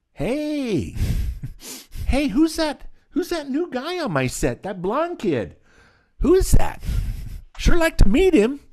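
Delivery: high-pitched impression of an eager director; Quentin Tarantino. Director asks about new kid on set, high excited voice. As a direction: call out in a keyed-up, high voice. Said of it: "Quentin goes".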